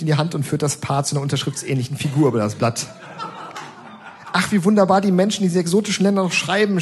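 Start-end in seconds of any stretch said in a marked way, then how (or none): 4.3-6.8 higher, lightly voiced gushing lady; a hint of a swooning do-gooder. Kind lady raving about the patient's signature, mock gushing. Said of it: "schwärmt die nette Dame".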